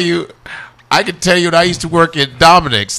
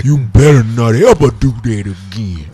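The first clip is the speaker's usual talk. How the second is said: slow, lower proper voice; articulate Oprah voice; 6 semitones lower than usual. Retold or imitated. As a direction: lightly imitate Oprah's careful diction. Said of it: "she was like".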